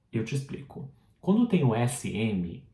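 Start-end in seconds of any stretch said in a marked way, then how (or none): 0.1-0.9 whisper. Acted. Answers pretend pupil question.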